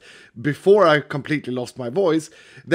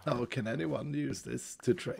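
hoarse, nearly gone voice, much softer; rasping through a wrecked throat; lost voice; 5 semitones lower, 14 dB quieter than usual. Real, not acted. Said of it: "Your voice was, like, completely ruined".

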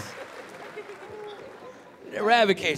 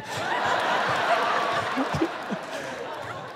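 breathy high laugh; long wheezing laugh; 9 semitones higher, 6 dB quieter than usual. Real, not acted. Comic laughing at his own story. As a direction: crack up at the memory.

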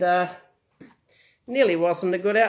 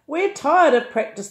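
sobbed; 6 semitones higher, 5 dB louder than usual. A narrator's reading. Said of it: "they sobbed".

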